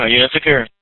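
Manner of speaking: ordinary talk, nothing marked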